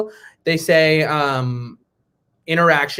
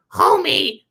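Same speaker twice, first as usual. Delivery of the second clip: high put-on Marge voice; Marge Simpson impression; 10 semitones higher than usual. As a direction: rasp out a high cartoon mom. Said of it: "I can do Marge Simpson".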